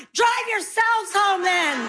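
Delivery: slow, high mock yell. Crazed mother to kids from highway, yelling. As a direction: yell it out, every word stretched. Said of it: "yelling at those kids going".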